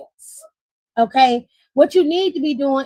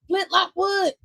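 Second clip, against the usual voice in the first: "Flint Lockwood" sung out higher, teasing; playful high name call; 7 semitones higher than usual. Real, not acted. Host calls the cartoon name to the co-host, teasing lilt. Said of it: "I just knew you was gonna say it like that".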